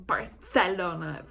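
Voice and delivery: slow, affected "Barcelona" with a th for the c; mock a tourist showing off "Barcelona" as Bar-THE-lona; pretentious, drawn-out lisped c in "Barcelona"; mock Castilian lisp. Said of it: "you're just like".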